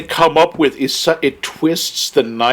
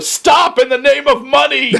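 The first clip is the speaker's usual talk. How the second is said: high, raised imitation yell; mock yell; 9 semitones higher, 4 dB louder than usual. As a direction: yell it like a comic movie villainess, on the edge of laughing. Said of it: "she's yelling".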